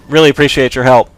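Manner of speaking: ordinary talk, nothing marked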